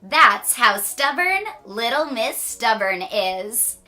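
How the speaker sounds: ordinary delivery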